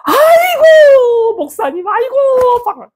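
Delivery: very high, drawn-out squeal of delight; gushing delighted greeting. Retold or imitated. Pastor's wife abruptly brightening on seeing the pastor, gushing greeting. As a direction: suddenly gush in an exaggerated high voice. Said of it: "얼굴이 갑자기 확".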